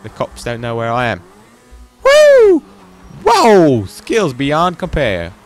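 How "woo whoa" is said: loud, very high "woo"; excited whoop. Real, not acted. Cheering own bike stunt.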